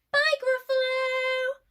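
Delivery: loud, high singsong call; called farewell. Acted. Children leaving the Gruffalo behind, high drawn-out call. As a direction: call goodbye slowly across the forest.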